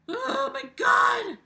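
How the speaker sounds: slow, high shocked exclamation; shocked shout. Real, not acted. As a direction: shriek in disbelief. Reacting to own burp.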